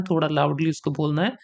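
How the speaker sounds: usual speaking voice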